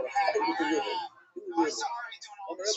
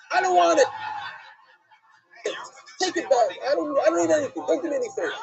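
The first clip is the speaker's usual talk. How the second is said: loud, high insistent refusal, pushing the gift back; emphatic refusal; 7 semitones higher, 4 dB louder, faster than usual. Acted. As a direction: insist loudly you want none of it. Mephiboshet refuses the king's offer.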